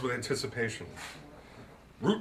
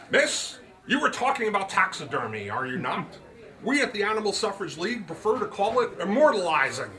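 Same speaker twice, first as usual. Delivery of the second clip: put-on higher, louder, grand old gentleman; Root's voice; 6 semitones higher, 3 dB louder than usual. A narrator's reading. Root lectures his guest on the magic word, higher and grand. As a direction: pronounce it like a showman, slowing on the magic word.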